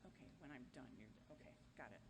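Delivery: very soft, low off-mic confirmation; low aside. Real, not acted. Speaker confirms the running order with a helper, low murmured aside. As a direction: murmur it privately, away from the audience.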